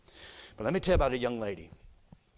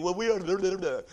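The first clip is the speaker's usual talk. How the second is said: mock whining; 7 semitones higher, 6 dB louder than usual. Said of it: "crybabies and thinking".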